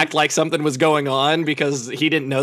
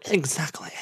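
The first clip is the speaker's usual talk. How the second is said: whisper; 3 semitones lower, 9 dB quieter than usual. Real, not acted. Whispered agreement.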